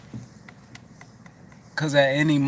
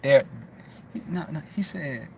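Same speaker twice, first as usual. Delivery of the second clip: normal talking voice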